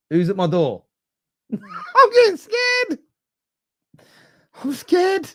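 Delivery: high-pitched laughing fright; nervous laughing scare. Real, not acted. Streamer hears bangs on his door, laughing scared. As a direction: laugh nervously through real fear.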